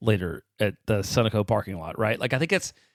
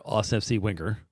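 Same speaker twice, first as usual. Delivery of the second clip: usual speaking voice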